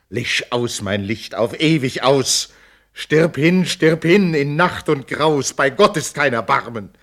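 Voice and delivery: desperate cry of a bride who wants to die; wild despairing cry. Acted. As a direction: let the despair break loose. Lenore wishing herself dead.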